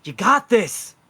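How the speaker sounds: high, loud rallying cry; cheering encouragement. Acted. Giving a player a little oomph, cheering. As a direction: shout it like a pep talk.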